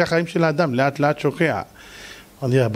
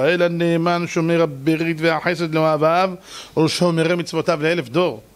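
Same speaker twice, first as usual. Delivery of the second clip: normal talking voice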